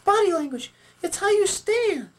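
very high puppet voice; Sally puppet, correcting. Acted. Sally corrects Jeremy about cheating out, correcting puppet voice. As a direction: set them straight in a squeak.

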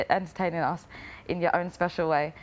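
ordinary talk

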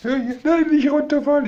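startled high warning; alarmed cry. Real, not acted. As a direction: cry out as the bird slips. Bird about to fall.

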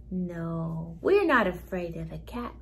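usual speaking voice

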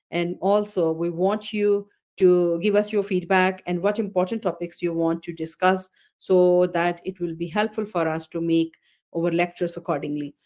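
usual speaking voice

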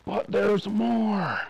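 slow, high doctor's warning. A narrator's reading. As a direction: draw it out like a solemn doctor. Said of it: "the doctor said".

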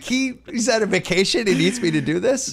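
high, baffled protest; incredulous complaint. Retold or imitated. Acting out reaction to tweet request.

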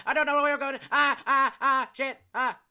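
high, loud terrified yelps; panicked screaming. Real, not acted. Jump scare, screaming and fleeing.

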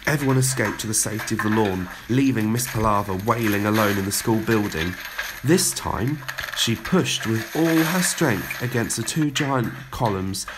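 plain reading voice

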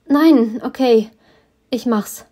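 high, loud panicked no, then giving in; blurting out surrender in fear; frightened giving in. A narrator's reading. Heroine, scared of another demonstration, gives in.

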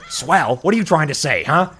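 touchy gangster bird, high and indignant; pissed-off wiseguy impression; Joe Pesci. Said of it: "talks just like Joe Pesci".

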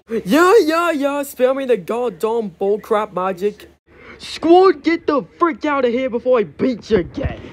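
rude character. Acted. Cartoon bully yelling at his squad to leave. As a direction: brush them off, then yell at the squad. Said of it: "test voicing characters".

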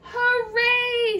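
high, loud hooray; joyful cheer. A narrator's reading. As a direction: cheer out in storybook delight. Snail sees cabbage coming.

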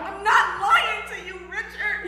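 loud, high protest, drawn out. Acted. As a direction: protest loudly to an accusing husband. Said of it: "did you just raise your voice at me".

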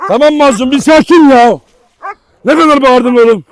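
loud exasperated scold; telling off the dog. Real, not acted. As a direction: raise the voice over the noise.

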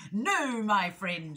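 loud, raspy weasel villain; threatening storybook predator; menacing rasp. A narrator's reading. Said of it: "she rasped".

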